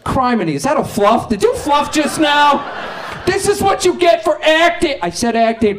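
high, shouting mock menace; hammed-up dad gangster yelling threats; prudish dad as gangster. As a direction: play a mobster who won't swear. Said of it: "it would be like".

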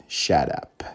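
low, whispered shut up; grandma at the end of her patience, low whisper; annoyed grandma. Retold or imitated. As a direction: hiss it like a fed-up grandma. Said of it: "she was annoyed".

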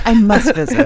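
airy and posh-sounding, laughing a little; pretentious. Pretend. Joking about Victoria trips, a hammed-up pretentious gush. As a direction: play the snob who insists on travel. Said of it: "the most pretentious thing you could say".

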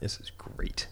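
barely audible unvoiced whisper; hushed aside. Real, not acted. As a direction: whisper it under your breath. Muttered aside at grim video.

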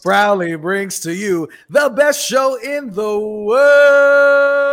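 booming circus ringmaster intro. Acted. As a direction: announce it big, like under the big top. Ringmaster style show opening.

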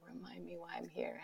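whispered sung line; faint whispered refrain. Retold or imitated. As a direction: let the refrain fade to a whisper. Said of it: "I started singing".

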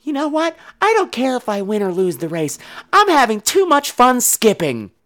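put-on high, light child's voice; childlike, slowing on the last phrase; carefree little girl. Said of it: "the other girl just keeps skipping, ... and she says".

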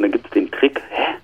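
ordinary talk, nothing marked

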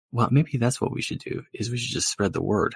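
ordinary talk, nothing marked

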